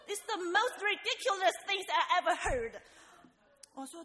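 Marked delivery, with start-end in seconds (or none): 0.1-2.8 much louder, higher, laughing scorn; scoffing disbelief. Retold or imitated. Supervisor mocking the idea of praying, loud laughing scorn. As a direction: laugh it off as absurd.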